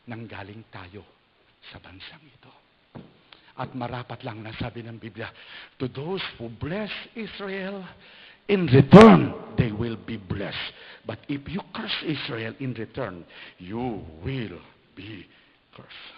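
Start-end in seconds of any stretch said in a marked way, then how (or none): 5.8-16.1 slow, loud, drawn-out preaching; preaching scripture. Acted. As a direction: proclaim the verse slowly. Quoting Bible, preaching emphasis. (mabagal at malakas na pangangaral)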